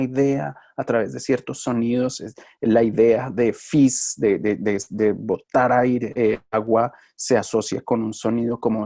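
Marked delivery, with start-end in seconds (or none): none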